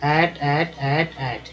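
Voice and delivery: ऐट said slowly four times, short vowel and crisp final t; pronunciation drill. Shown as a demonstration. Teacher drilling students on the AT sound, carefully pronounced. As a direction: drill ऐट like a teacher, a clean short "ait" each time. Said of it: "एटी को ऐट बोलते हैं".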